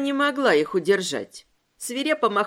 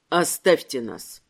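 low, slow order; cold command; 8 semitones lower than usual. A narrator's reading. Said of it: "приказал Кассий".

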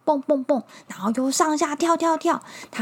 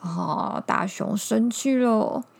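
ordinary delivery

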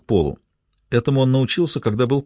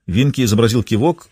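ordinary delivery

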